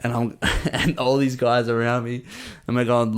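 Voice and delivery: ordinary delivery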